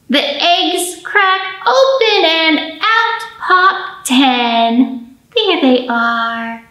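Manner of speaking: slow, sing-song rhyme; fingerplay rhyme. Acted. Librarian chanting a finger rhyme to children. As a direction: chant it for little ones.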